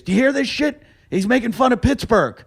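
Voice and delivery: high-pitched mock outrage; imitating indignant heckler. Heckler appealing to whole audience.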